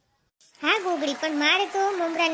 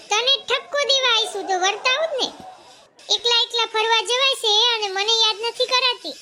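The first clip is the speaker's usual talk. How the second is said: put-on old woman voice; 6 semitones higher, 5 dB louder than usual. The elder old woman demanding to be recognised, higher and louder. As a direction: play the grumbling household elder.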